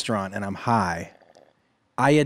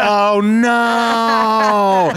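higher, louder dismayed groan; mock dismay; 8 semitones higher, 5 dB louder than usual. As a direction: wail it in embarrassed dismay. Dismay at being recognized.

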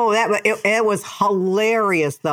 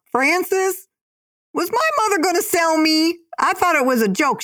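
high, louder plea to his aunt; asking his aunt in disbelief, pitched up; Todd on the phone; 11 semitones higher, 3 dB louder than usual. Retold or imitated. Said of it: "Todd calls her up".

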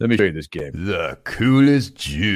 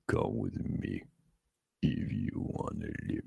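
breathy whisper, much softer; whispered famous line; 7 semitones lower, 15 dB quieter than usual. Shown as a demonstration. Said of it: "I'm gonna do this line for all these Stallonians".

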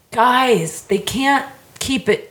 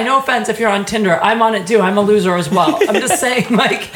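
ordinary talk

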